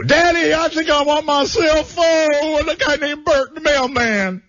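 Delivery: high, swooning son's voice; mock son's confession. Son confesses to his dad, high and swooning. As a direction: coo it up high like a nervous son.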